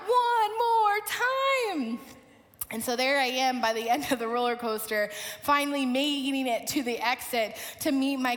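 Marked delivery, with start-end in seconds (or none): 0.1-2.0 high, drawn-out call-out; mock cheering call. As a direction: stretch each word like a ride cheer. Ride call repeated in mock cheer.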